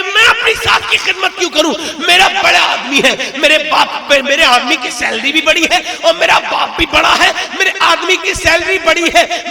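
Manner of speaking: high, shouting caricature of a haughty wife, laughing; mocking arrogant wife. Preacher mocking wives who refuse to serve in-laws, shrill caricature. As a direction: lampoon a boastful daughter-in-law. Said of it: "औरतें कहती हैं".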